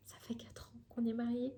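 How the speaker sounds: soft unvoiced whisper; whispered aside. Real, not acted. Whispering to her viewers about four years married. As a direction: let this slip out as a secret.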